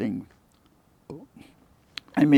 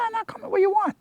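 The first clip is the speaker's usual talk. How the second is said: high retold reply. Retold phone call, high voice.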